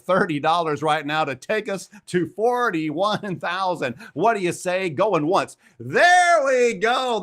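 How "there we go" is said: high, loud, stretched out cheer; drawn-out triumphant "There we go"; thrilled cheer. Real, not acted. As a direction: celebrate the goal being hit. Donation goal hit.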